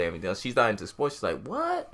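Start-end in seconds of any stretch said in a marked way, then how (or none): 1.5-1.9 very high, questioning what; retold shock. Boss not into sports hearing the news, startled. Non-fan's retold reaction.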